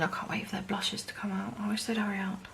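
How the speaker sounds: impatient little whisper; whispered aside. Real, not acted.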